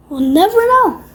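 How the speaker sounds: mock-dramatic sigh, high and loud. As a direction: play it like a grand mystery. Dramatic musing over fallen phone.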